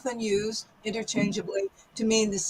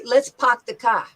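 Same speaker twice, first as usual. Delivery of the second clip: New York accent with the R's dropped from park and car; 3 dB louder than usual. Shown as a demonstration. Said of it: "But a New Yorker would say".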